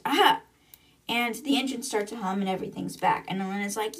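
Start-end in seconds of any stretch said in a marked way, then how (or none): 0.0-0.4 sudden high, louder exclamation; triumphant cry. Retold or imitated. Engineer finally gets the circuits going, triumphant mock cry. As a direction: burst out as the fix works, acted.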